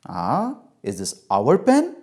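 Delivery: slow, doubting question; drawn-out, pointed disapproval; disapproving mother. Acted. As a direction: ask it slowly, hinting the answer is wrong. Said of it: "look of disapproval from my mother".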